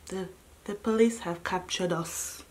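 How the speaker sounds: low, quiet, defeated-sounding reveal; hushed announcement. Retold or imitated. Telling listeners the police caught the group, hushed and low. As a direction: drop to a soft, grave undertone. First take of dramatic announcement.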